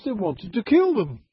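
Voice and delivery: ordinary talk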